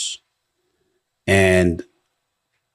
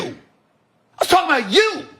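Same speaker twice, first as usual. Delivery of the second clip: higher, drawn-out shout ending on a rise; slow down and shout it this time; shouted repeat; 10 semitones higher than usual. Real, not acted. Shouting his point back at the father.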